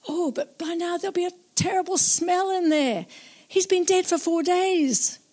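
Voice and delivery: high, anxious protest from Martha; Martha blurting out. Acted. Grieving sister protests opening the tomb, high and anxious. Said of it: "she blurted out".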